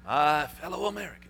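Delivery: a hint of a presidential impersonation; Nixon voice. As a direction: put on a touch of an old president.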